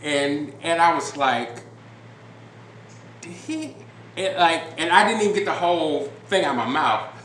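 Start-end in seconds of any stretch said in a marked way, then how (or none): 3.2-3.8 high, soft incredulous half-question; imitated stunned disbelief. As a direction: start a shocked question under your breath, high-pitched. Stunned driver reacting to officer's remark.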